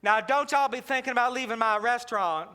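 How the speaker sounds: loud, cheery Southern hostess voice; Southern waitress. Retold or imitated. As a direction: bellow it like a jolly diner waitress. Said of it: "She said".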